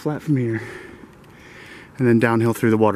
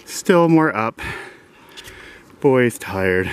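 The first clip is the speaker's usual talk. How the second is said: usual speaking voice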